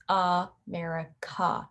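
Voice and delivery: "America" with relaxed schwa at both ends. Shown as a demonstration. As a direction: say "America" with a lazy uh at start and end. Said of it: "the word America doesn't use either".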